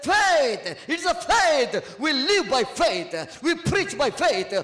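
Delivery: loud, slow, hammered preaching; slow booming sermon cadence, each phrase a hammer blow; preaching at full force. Acted. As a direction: pound out every faith line from the pulpit. Preacher pounds faith lines.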